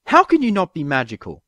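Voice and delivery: high, loud accusing yell. A narrator's reading. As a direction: glare and yell at the notebook. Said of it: "she yelled at the killer notebook".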